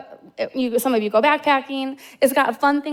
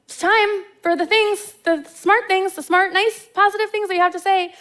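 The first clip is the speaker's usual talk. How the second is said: pleading urge; 8 semitones higher than usual. Retold or imitated. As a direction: nudge her to say the magic words. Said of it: "looking at her like".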